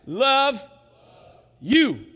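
loud, high, word by word; big deliberate call-out with gaps; call to repeat. Shown as a demonstration. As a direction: lead a crowd, pausing after each word. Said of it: "repeat after me".

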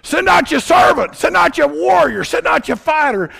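high, loud taunting shout; Goliath taunting Israel, yelling; giant yelling. Retold or imitated. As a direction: roar a challenge across the valley. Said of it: "He's yelling and saying".

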